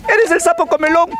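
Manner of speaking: high, tiny fearful question; scared little worm. Acted. Little worm meets a big green thing, high scared question. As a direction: squeak it nervously at the monster.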